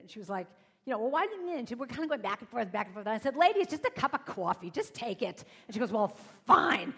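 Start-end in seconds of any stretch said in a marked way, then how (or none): 1.0-1.6 higher, louder retort; arguing stranger. Retold or imitated. Woman bickering over coffee, higher and louder. 3.3-5.4 louder, higher pushy plea; just take it, already; exasperated insistence. Retold or imitated. Speaker insisting the woman accept coffee, loud. 5.9-6.9 louder huffy giving in; grudging loud fine. Retold or imitated. Woman finally accepting the coffee, loud and grudging.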